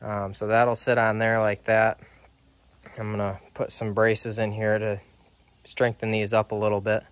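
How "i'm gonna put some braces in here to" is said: ordinary delivery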